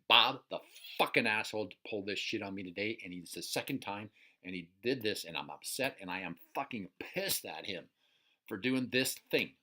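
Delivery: loud, full-throated angry rant at Bob; enraged shout at the neighbor who wronged you; furious rant. Shown as a demonstration. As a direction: let the rage pour out, shouting. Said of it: "we actually engage the feeling".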